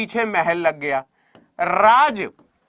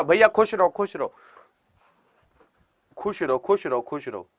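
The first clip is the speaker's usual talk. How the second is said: blessing repeated, trailing softer to almost nothing; fading blessing; 3 semitones lower, 3 dB quieter than usual. Acted. Teacher blessing a student by name, fading softer. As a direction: bless them gently, letting it fade.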